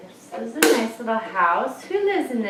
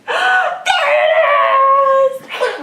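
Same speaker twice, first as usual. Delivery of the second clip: very high, slow, delighted cry; excited squeal; 12 semitones higher, 7 dB louder, much slower than usual. Real, not acted. Cheering toddler's find.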